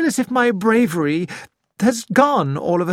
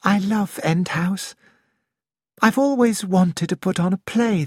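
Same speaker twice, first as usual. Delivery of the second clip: dreamy voice; 6 semitones higher than usual. Acted. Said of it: "it was in a dreamy voice".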